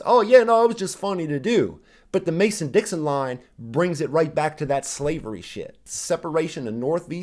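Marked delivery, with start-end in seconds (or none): 0.0-1.8 higher, louder, breezy mock of a flippant critic; mocking dismissive imitation. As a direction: toss it off like it's nothing, sneering. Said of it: "The Anthony Fantano fucking clown car just thinks".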